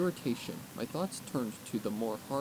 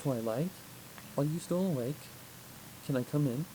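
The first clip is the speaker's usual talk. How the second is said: gentle princess voice; 3 semitones higher than usual. Acted. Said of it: "Celestia's voice floated in".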